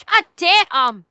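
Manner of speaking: ordinary talk, nothing marked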